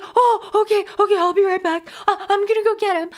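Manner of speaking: hurried mock panic, fast; flustered receptionist. The receptionist rushing off to fetch the doctor, flustered and hurried.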